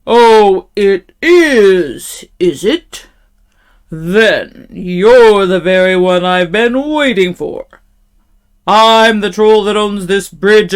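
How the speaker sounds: loud, slow, menacing troll; terrible troll voice. A narrator's reading. Troll threatening the middle-sized goat, loud and slow. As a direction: threaten it loudly, stretching the words. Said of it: "in his great, terrible voice".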